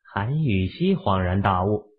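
ordinary delivery

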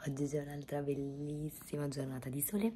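hoarse near-whisper; barely any voice left; voice giving out. Real, not acted. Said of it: "non so quanto riuscirò a parlare oggi perché male male".

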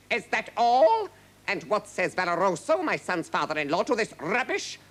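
high, loud at first, scornful; furious king. A narrator's reading. King sneering at the herald's challenge, furious. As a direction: demand answers in a royal temper. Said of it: "Padella was furious".

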